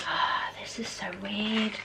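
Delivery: soft whispered wonder; hushed whisper. Real, not acted. Vlogger staring at childhood photos, in a hushed whisper. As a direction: breathe it out in a whisper.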